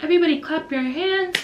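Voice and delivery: lightly voiced teacher getting everyone's attention; teacher rallying class.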